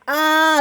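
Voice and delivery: high, loud cry; alarmed scream. A narrator's reading. Both engines hit by Percy, alarmed scream. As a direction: yell out on impact.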